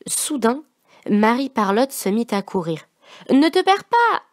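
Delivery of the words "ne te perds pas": put-on high, louder call after a child; father crying out to his daughter. A narrator's reading. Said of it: "cria Mot Tordu".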